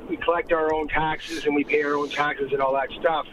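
ordinary delivery

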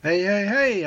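ordinary talk, nothing marked

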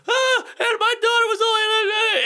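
very high, slow wailing sob; mock weeping. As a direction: blubber it out like a grieving dad. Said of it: "he just starts weeping".

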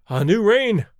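ordinary delivery

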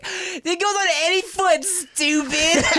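high, laughing put-down; mocking kid taunt. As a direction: sneer it like a bratty kid. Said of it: "And we're all like".

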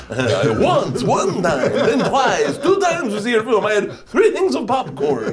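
laughing through a silly Transylvanian mafia voice; Transylvanian mafia accent. Retold or imitated. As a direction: squeak it out like a giggling Transylvanian gangster. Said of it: "The Transylvanian Mafia".

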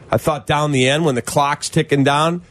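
ordinary talk, nothing marked

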